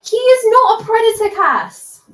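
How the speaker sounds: high, loud, emphatic denial; indignant high-pitched objection; indignant protest. Acted. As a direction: protest it hard, pitch jumping right up. Heated in-character defence of a man.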